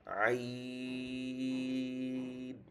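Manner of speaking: আইন held very long from mid-throat; held Arabic letter. Shown as a demonstration. Teacher demonstrating the letter আইন from the throat, long held. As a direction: stretch আইন from the middle of the throat. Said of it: "হলকের মাঝখান থেকে".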